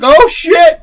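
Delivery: high, loud oh shit; startled exclamation; mock alarm. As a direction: jump scare reaction. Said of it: "you're like".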